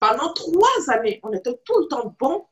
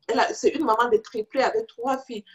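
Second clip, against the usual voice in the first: ordinary delivery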